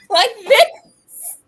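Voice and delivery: high, loud laughing through the words; can't stop laughing while retelling; giggling through the story; laughing while telling. Real, not acted. Retelling sitting frozen, laughing.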